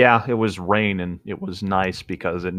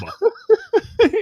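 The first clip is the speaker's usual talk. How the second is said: long high laughter. Real, not acted. Listener laughing hard at the cat's name, a high laugh. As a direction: crack up at the story.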